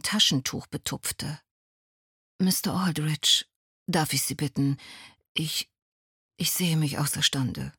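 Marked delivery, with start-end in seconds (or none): 2.4-7.7 hoarse, half-whispered, fighting back tears. A narrator's reading. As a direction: she can barely get the words out. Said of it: "sagte sie mit heiserer Stimme".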